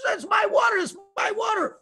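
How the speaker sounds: high-pitched insistent protest; protesting water owner. Acted. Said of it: "he says".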